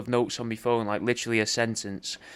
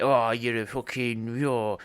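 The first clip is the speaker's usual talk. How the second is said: mock angry. Shown as a demonstration. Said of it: "angry, like".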